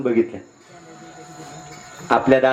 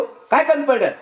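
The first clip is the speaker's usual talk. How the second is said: high-pitched protest; indignant outcry; 10 semitones higher than usual. Acted. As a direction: demand an answer in outrage. Elder brother protests the split.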